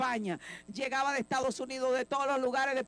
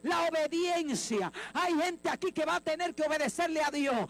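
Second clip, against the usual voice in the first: fiery preaching; 4 semitones higher than usual. Acted. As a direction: preach it out to the whole church at full force. Preacher rising to full force.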